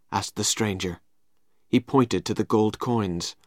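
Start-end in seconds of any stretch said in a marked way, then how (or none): none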